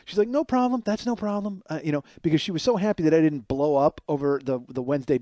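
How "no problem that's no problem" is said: mock cheery, pitched up high; high sugary reassurance; eager high agreeable voice. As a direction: play her as overly eager to please. Lily eager to please.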